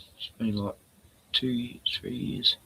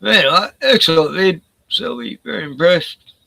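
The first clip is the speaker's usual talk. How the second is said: excited; 7 semitones higher, 9 dB louder than usual. Real, not acted. Praising a viewer's video.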